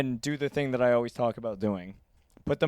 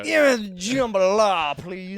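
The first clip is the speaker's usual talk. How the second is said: slow, higher drawl demanding jambalaya; mock Southern accent; 6 semitones higher, 5 dB louder, slower than usual. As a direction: lay the mock accent on thick. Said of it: "I don't know what accent you're doing".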